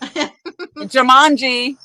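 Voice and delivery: stretched slow and dramatic; drawn-out Jumanji. Pretend. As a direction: draw it out like a movie title. Drawn out joke about stampede.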